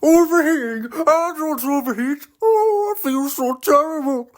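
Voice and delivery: very high whiny car voice; whining car. Acted. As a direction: protest weakly, then moan. Car denies overheating.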